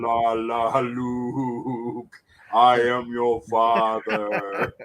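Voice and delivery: low, slow, breathy villain growl; Darth Vader voice. Pretend. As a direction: boom it out like a helmeted villain. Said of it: "sound like Darth Vader".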